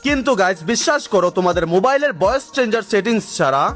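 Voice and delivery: usual speaking voice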